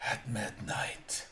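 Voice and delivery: slow, soft, voiceless whisper of doom; ominous whisper. Acted. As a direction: let death drop it to a whisper. Death's ominous final line.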